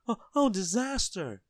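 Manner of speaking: high, loud wail of disaster; terrified scream. A narrator's reading. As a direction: cry out in high-pitched horror. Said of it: "he screamed".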